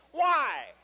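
high, very loud wail; hammed-up child whine; protesting child. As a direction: yelp it like a thwarted kid. Child protests being refused water.

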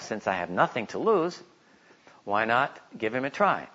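normal talking voice

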